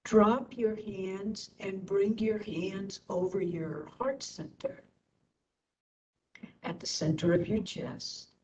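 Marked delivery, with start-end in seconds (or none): none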